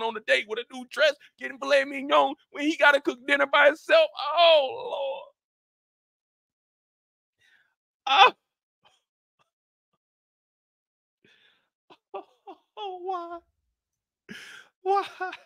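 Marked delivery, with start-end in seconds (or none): 4.4-15.3 hammed-up sobbing lament; mock crying. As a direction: ham up the despair. Mock crying over the story.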